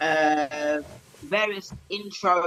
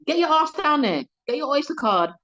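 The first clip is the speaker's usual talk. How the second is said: loud, high-pitched telling-off; scolding order; 7 semitones higher, 5 dB louder than usual. Acted. Urging stay-at-home Londoners to travel, loud scolding.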